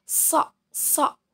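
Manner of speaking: two separate demo takes of a heavy "So"; crisp, emphatic "So" for students to copy; emphatic Arabic shad. Shown as a demonstration. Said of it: "sedikit naik ke atas".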